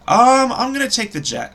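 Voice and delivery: blurted out high and loud, then cut off; impulsive blurt. Real, not acted. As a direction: jump the gun on the pick. Blurts a pick then corrects.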